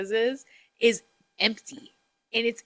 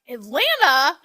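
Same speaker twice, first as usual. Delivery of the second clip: 1 mock incredulity; 5 semitones higher, 6 dB louder, slower than usual. Incredulous retold reaction.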